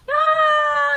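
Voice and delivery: high, loud shriek of delight; excited scream. Real, not acted. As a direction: shriek at the wild hair. Child's messy hair. (kusog nga singgit)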